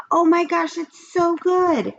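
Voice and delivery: high, slow gushing praise; excited little girl. Acted. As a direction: swoon over the flavor. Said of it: "said Leslie".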